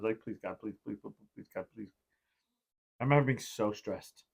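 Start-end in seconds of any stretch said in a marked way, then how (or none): 0.2-1.9 murmured prayer. Retold or imitated. Retold desperate prayer, very soft.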